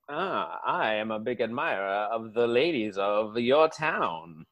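put-on low, soft southern gentleman accent; mock-courtly, low southern accent; genteel southern accent. Southerner admiring the town's ladies, genteel southern accent. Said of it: "genteel way that you guys talk".

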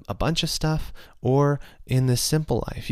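ordinary talk, nothing marked

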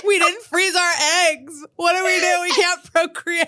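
fake-cry it with a laugh at the end; mock sobbing. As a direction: drunk girls mock sobbing about frozen eggs. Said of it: "like, sobbing".

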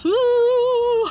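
high, drawn-out "woo"; mock haunted-house howl, held long; cartoon frightened wail. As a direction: wail like a spooked cartoon pig. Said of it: "Like Porky Pig in a haunted house".

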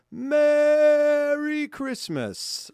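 high, long-held "Merry" dropping into "Christmas"; drawn-out festive greeting. Acted. Host reading out a listener's card, drawn-out festive cheer. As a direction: sing out a big, stretched holiday hello.

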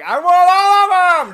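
put-on high, loud childlike yell of wanting everything; greedy excited shout. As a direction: shriek it like a kid in a candy store. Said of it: "and I'm like".

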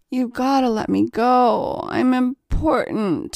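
put-on drunken slur. A narrator's reading. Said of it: "he slurred at her".

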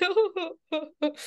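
high, wordless crying hoots; mock sobbing. Retelling her tears after class, mock sobbing. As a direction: imitate a high-pitched sobbing fit. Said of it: "and I started crying".

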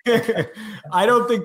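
high, laughing false start. Real, not acted. Panelist restarting his answer after co-host's interruption, laughing.

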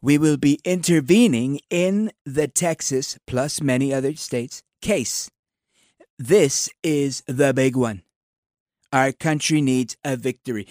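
slow, drawn-out Trump impression; slow presidential drawl, big pauses. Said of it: "let me do it in my president voice".

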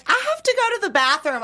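high, fast panicked excuse; mock hysterical. Woman retells fleeing the table, mock hysterical. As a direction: blurt it as if about to cry. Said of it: "I'm hysterical".

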